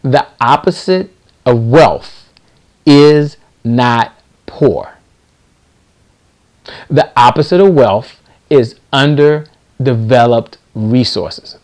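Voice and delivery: very slow, word by word with long pauses. Real, not acted. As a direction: spell it out slowly and firmly so they can note it. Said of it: "let me say it a little slower so you get this".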